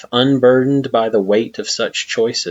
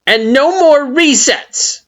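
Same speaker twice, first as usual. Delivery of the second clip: very high, loud, slow demand on more; stern Flowey; 6 dB louder, slower than usual. A narrator's reading. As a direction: press the last condition hard.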